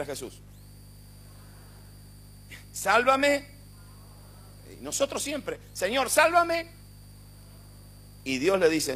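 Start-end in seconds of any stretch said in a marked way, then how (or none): 2.7-6.8 high, loud imitated cry for help; mock plea. Preacher mimicking believers begging God, loud and high. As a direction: cry out like a desperate crowd. People crying to Jesus.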